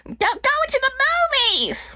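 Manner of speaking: very high, screechy mock mom voice; exaggerated high-pitched nagging impression; imitating bratty kid's mom. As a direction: squawk it way up high, sending her up. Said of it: "That's how she talks, by the way".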